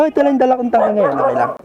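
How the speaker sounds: ordinary talk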